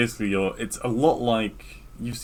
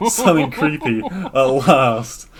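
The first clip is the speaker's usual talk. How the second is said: slow, loud, higher, laughing delivery; 6 semitones higher, 8 dB louder, slower than usual. Real, not acted. Recounting the game's first creepy moment, laughing. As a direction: say it through a big laugh. Laughing through his excited retelling.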